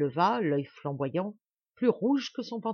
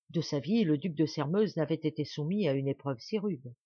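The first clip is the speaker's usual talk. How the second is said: plain reading voice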